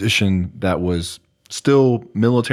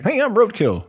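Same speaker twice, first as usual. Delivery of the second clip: much higher squeaky imitation; mock Roadkill; 14 semitones higher than usual. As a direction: parody the squeaky guy introducing himself. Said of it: "a high pitched voice".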